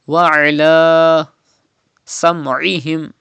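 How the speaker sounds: "Wa ala sam'ihim" slowly with mid-throat Ain; throaty Ain. Shown as a demonstration. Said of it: "Pronounce Ain from the middle part".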